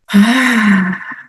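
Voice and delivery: slow, audible exhale; deep breath out. Shown as a demonstration. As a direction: let a long meditative sigh go. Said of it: "Breathing in and breathing out".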